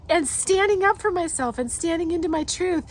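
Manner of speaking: usual speaking voice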